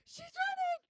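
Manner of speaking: high, loud imitation of panicked shouting; frantic shouting. Retelling report to the nine one one operator, frantic shout. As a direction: shout the alarm as if reliving it.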